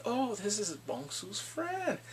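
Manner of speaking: slow, soft, drawn-out realisation; mock discovery. Kidnapper finds her phone, slow mock realisation. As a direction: imitate a sly slow discovery.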